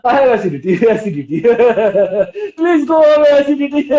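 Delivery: words broken up by forced laughter; laughing at illness. Shown as a demonstration. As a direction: laugh right through the complaint, as a demonstration. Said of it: "you can laugh on your disease".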